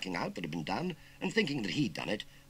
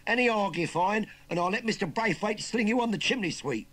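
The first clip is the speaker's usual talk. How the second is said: loud, high Cockney bullying; insistent Cockney threat; 13 semitones higher, 8 dB louder than usual. Acted. Nephew threatening uncle with the farmer, insistent. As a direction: press the threat loudly. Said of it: "insisted the Cockney".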